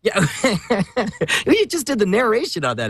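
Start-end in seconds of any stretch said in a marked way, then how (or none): 0.3-1.4 a quick real chuckle; short laugh. Real, not acted. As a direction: laugh briefly at the joke. Laughing at the Arrested Development joke.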